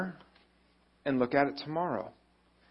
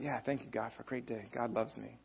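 soft grateful prayer; 3 semitones lower, 12 dB quieter than usual. Retold or imitated. Quietly writing a grateful prayer.

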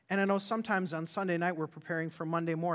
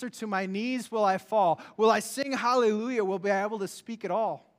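song words declaimed louder, rising; recited lyric; 3 semitones higher, 5 dB louder than usual. Shown as a demonstration. Preacher reciting the MercyMe song to the congregation, fervent. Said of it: "It says".

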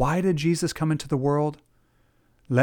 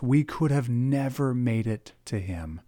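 usual speaking voice

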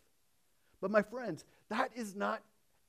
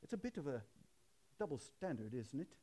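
a light Canadian accent, imitating Doctor Carson; 3 semitones lower, 12 dB quieter, faster than usual. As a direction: lightly mimic a Canadian professor's gentle challenge. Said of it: "he kinda talks like this all the time".

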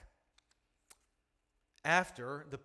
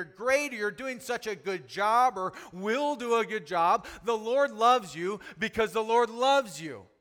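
loud, driving sermon voice; full-force preaching; 8 semitones higher, 9 dB louder than usual. Acted. Preacher to the congregation, a full-force sermon. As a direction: preach it hard to the room.

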